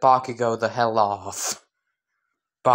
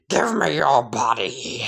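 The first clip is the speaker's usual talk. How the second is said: raspy, half-whispered monster demand; sludge villain. Acted. Sludge villain bursting from the sewer at Deku, menacing rasp. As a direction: rasp it out like a lunging slime monster. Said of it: "sludge villain would burst from the sewer grate and just say".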